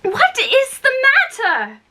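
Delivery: high, urgent exclamation; calling over screams. A narrator's reading. Alice tries to be heard over the Queen, crying out high. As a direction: pitch it way up to be heard. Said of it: "as soon as there was a chance of making herself".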